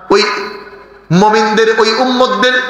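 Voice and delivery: high, half-sung sermon chant; chanted pulpit exhortation; melodic preaching. Real, not acted. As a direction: intone it high, holding the phrase endings.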